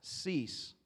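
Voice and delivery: "cease" set apart as a word alone; single weighted "cease", then silence; stress on cease. Shown as a demonstration. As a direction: land on cease and let it hang. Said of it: "we're emphasizing one facet".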